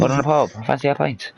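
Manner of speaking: put-on high-pitched comic Welsh accent. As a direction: put on a lilting, jokey Welsh voice. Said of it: "when you do that Welsh accent".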